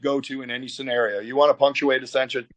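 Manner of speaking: ordinary talk, nothing marked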